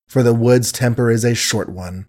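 ordinary delivery

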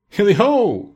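high, bright jovial holler; old master rallies his apprentices, cheerfully; merry cry. A narrator's reading. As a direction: call the lads out with glee. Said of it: "cried old Fezziwig".

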